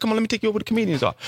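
fast, high hustling promoter; fast high mock promoter; rushed promoter. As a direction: hurry him along as the promoter. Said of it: "He said".

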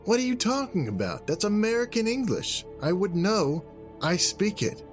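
very high-pitched, exasperated protest; incredulous arguing. Acted. Jack arguing about which language is spoken, incredulous. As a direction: insist in disbelief, voice shooting up.

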